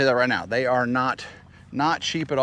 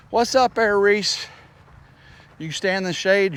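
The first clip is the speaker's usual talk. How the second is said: cheerful pet greeting; 7 semitones higher than usual. Real, not acted. Greeting horse Reese.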